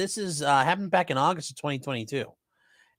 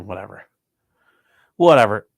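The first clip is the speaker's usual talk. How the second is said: dismissive; 7 semitones lower, 7 dB louder than usual. Real, not acted. Gives up on bone name.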